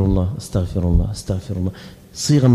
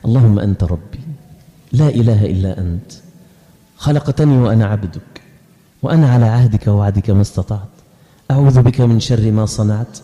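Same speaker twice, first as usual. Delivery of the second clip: heartfelt quiet recitation; 8 semitones lower than usual. Shown as a demonstration. Preacher showing how to say the prayer at night with feeling, soft and low. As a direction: pray it softly as if alone at night. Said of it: "وانت مستشعرها كده".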